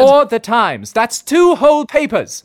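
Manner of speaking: shrill incredulous scolding; exasperated outburst. Acted. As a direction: throw your hands up in disbelief. Exasperated at partner's ignorance.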